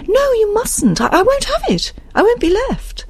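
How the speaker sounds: very high, frantic refusal; high-pitched frightened insistence; panicked protest. Acted. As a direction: beg not to be left, stammering in fear. Frightened Isabel refuses to be left.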